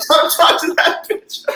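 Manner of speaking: high, slow, through laughter; giggly mock farmer; laughing repeat. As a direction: quote it while cracking up. Listener repeats punchline.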